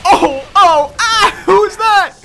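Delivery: high, loud, stretched-out shriek; startled shriek. Real, not acted. Streamer ambushed by an unknown enemy, shrieking. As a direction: scream as something jumps you.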